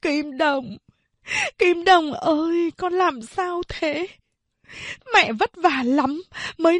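a mother weeping over her son; tearful lament. Acted. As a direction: wail over a lost son, voice climbing. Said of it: "ôm lấy Kim Đồng mà khóc".

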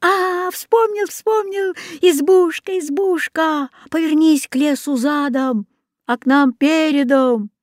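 high, eager recital; excited calling out. A narrator's reading. Boy remembers the words for the hut, calling excitedly. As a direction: cry out as memory returns, pitched high.

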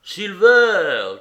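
loud, high cheer of the name; crowd's cry. A narrator's reading. Mutineers acclaim their leader, loud cry. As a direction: shout it out as a rallying cry. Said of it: "crièrent-ils".

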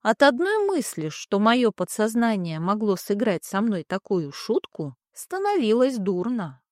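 plain reading voice

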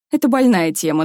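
ordinary delivery

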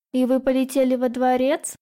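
lightly voiced child's teasing question; sly little daughter. A narrator's reading. Little daughter prods mother about the palace flight, slyly. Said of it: "Лукаво прищурилась Анхелика".

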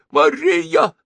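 high, loud cry of the name; the old consul shouting back joyfully; high, loud shout of one name; old man shouting. A narrator's reading. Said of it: "закричал Старжин Фитц".

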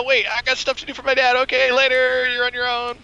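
high, whiny brush-off; whiny kid voice. Retold or imitated. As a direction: squeak it out like a scared kid. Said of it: "he was like".

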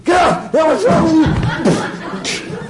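high-pitched hammed-up yell; mock impatient scolding. Preacher acting a bad shepherd to someone crying spiritually, mock scolding. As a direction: berate them in exaggerated exasperation. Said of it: "So you start going".

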